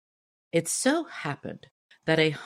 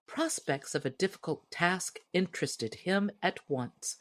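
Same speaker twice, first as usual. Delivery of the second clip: ordinary delivery, nothing marked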